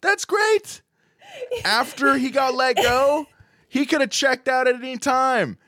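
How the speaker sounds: gushing through laughter; defending his pick while co-hosts tease him, laughing high; laughing excitement. Real, not acted.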